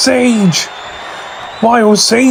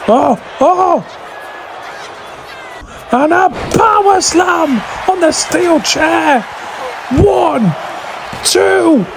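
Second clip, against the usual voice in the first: excited shout; 6 semitones higher than usual. Real, not acted. Power slam call, shouting.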